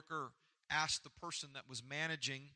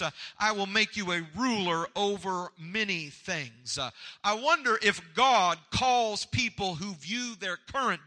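preaching; 4 semitones higher, 9 dB louder than usual. Real, not acted. Preacher building to full force.

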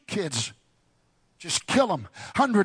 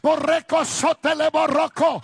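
speaking in tongues; 10 semitones higher than usual. Real, not acted. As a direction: pour out tongues in prayer. Praying in tongues.

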